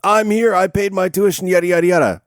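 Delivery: high, put-upon protest; whiny student. Retold or imitated. Expelled student protests to the teacher in a high whine. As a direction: whine about paying tuition.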